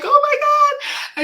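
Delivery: high, loud, drawn-out squeal of joy; delighted squeal. Retold or imitated. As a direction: squeal it, thrilled beyond words. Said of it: "I was like".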